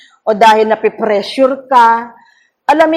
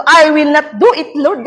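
higher, louder pleading refusal; retold refusal; 6 semitones higher, 3 dB louder than usual. Pastor recalling her fearful refusal to Lord. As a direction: beg Lord to spare her. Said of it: "At pangatlo".

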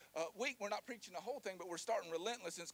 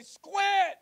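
high yelled plea, much louder; shouting; 8 semitones higher, 10 dB louder, much slower than usual. Pretend. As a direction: yell it to get away. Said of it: "I'm gonna say".

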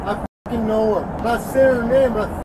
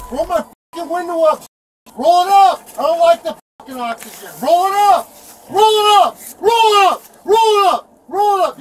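loud, higher repeated shouted order; angry yelling; 4 semitones higher, 7 dB louder than usual. Real, not acted. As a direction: bark it over and over. Arrestee yelling at officer.

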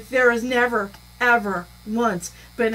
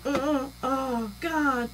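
soft, breathy fluster; mock distressed woman; 2 semitones higher, 7 dB quieter, much slower than usual. Imitating the flustered woman on the call, softly distressed. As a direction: sigh it out, flustered. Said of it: "she's like".

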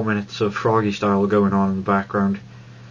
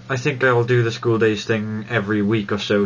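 usual speaking voice

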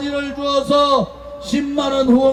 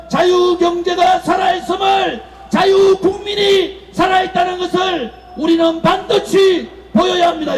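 roaring rallying speech; 4 semitones higher than usual. Acted.

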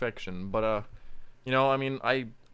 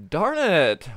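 "Darn it!" shouted out, higher and louder; loud anguished cry; 7 semitones higher, 8 dB louder than usual. Shown as a demonstration. Voice actor recording a line over a dead character, loud cry. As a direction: belt out "Darn it!" over a fallen comrade. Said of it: "I'm not saying "dang it".

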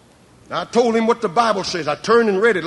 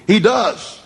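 normal talking voice

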